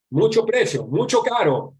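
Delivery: high, loud mock haggling in broken Spanish; imitated Chinese shopkeeper. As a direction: imitate a shopkeeper objecting to the price. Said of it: "cuando el chino habla bajito".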